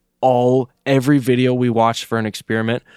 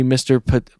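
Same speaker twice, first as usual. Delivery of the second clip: ordinary delivery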